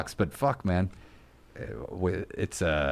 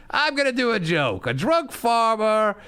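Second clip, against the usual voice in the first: imitating Gilbert; 10 semitones higher than usual. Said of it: "he's like".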